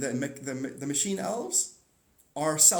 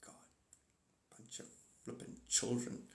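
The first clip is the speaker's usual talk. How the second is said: unvoiced, breathy aside; exasperated whisper; 5 semitones lower, 15 dB quieter than usual. Real, not acted. Fed up with his viewers, a whispered aside. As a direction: whisper it in despair.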